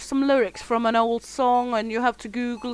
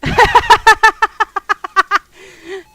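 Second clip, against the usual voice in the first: high, loud cackling laugh; loud burst of laughter; 9 semitones higher, 12 dB louder than usual. Real, not acted. Big laugh at chat.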